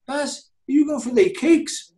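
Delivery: high mock voice asking about cakes; Moyes impression. Teammate mimics the manager's Friday cake request, high and teasing. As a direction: squeak the question up high, teasing. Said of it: "So Moyes would give it the".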